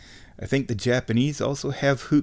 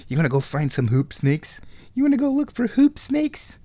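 pet talk; 5 semitones higher than usual. Real, not acted. Talking to her cat Pippa in high baby talk. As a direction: coo it at the kitty. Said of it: "I got Pippa sitting here".

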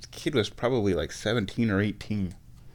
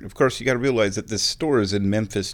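ordinary talk

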